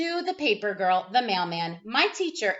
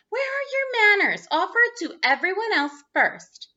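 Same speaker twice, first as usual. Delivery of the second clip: high, loud scolding mother; 8 semitones higher, 4 dB louder than usual. A narrator's reading. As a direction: tell him off like a shocked mum. Mother scolding her son.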